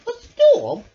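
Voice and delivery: high, loud alarmed question; loud surprised rabbit voice; startled Rabbit. A narrator's reading. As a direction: jump at the news. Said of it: "cried Rabbit".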